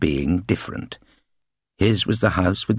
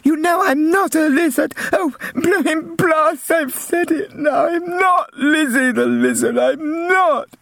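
very high, slow, whiny near-tears; upset little girl. Acted. Little sister blurting her nickname and crying, tearful whine. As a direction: protest tearfully as a child.

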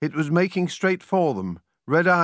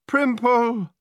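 high-pitched cry of despair; 10 semitones higher, 4 dB louder than usual. A narrator's reading. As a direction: cry out the name in dismay. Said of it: "Gemma, in despair, cried".

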